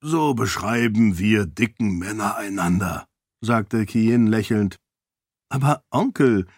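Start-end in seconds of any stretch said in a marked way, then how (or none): 5.5-6.5 sugary grin. A narrator's reading. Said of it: "mit einem süßlichen Grinsen".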